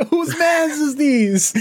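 high, slow, laughing. Real, not acted. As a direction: laugh through it.

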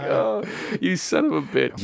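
slow, high laughing exclamation; laughing curse. Retold or imitated. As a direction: draw it out through laughter. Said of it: "I was like".

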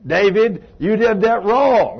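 playful imitation of a loud scolding God; loud rebuke. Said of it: "him to jump up and say".

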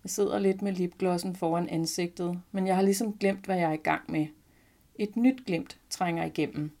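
ordinary delivery, nothing marked

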